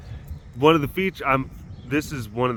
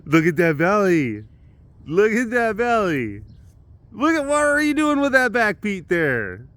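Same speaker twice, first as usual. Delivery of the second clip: gushing pet talk; 9 semitones higher, 3 dB louder than usual. Real, not acted. Owner admiring the cat's belly, gushing high. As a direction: gush over the animal in a high coo.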